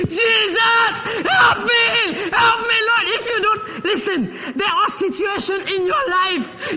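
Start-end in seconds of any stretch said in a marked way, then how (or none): 0.1-3.0 high, loud pleading cry; desperate cry for help. Retold or imitated. Preacher voicing the blind man, crying out. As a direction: beg Jesus like the blind man. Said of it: "I gotta be".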